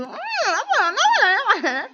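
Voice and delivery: high wailing mock despair; exaggerated dismay at the game; dramatic aman. Real, not acted. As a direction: moan it like a hammed-up tragedy.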